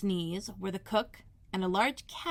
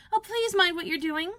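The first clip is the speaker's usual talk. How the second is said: much higher, louder panic; Alice panicking as dishes fly; terrified cry; 10 semitones higher, 5 dB louder than usual. Acted. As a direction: cry out in alarm. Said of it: "cried Alice ... agony of terror".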